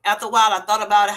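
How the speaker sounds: usual speaking voice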